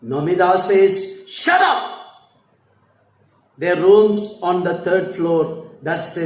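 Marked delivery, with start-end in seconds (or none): 1.3-1.8 sharp high angry shout. Acted. Wife shouting at her husband, angry. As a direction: snap it out in fury. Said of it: "Now she is very angry".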